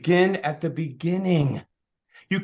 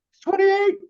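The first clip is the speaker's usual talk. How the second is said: very high, loud squeal of amazement; 8 dB louder than usual. Acted. Trainer marvelling at a board member's age, high squeal. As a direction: squeal it as if astounded.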